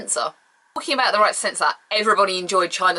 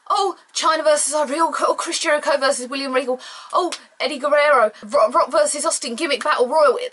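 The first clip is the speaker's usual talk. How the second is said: excited; 7 semitones higher than usual. Retold or imitated. Excited fan listing upcoming matches.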